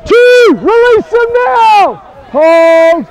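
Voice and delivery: shouted calls. Real, not acted. Referee calling over the play.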